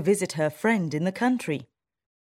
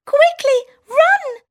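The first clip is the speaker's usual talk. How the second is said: very high, loud squeaky cry; shouting mouse; 10 dB louder than usual. Acted. Town mouse warning her friend of the people, loud high squeak. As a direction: sound the alarm in a tiny voice. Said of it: "shouts the town mouse".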